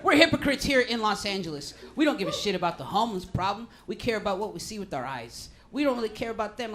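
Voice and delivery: ordinary talk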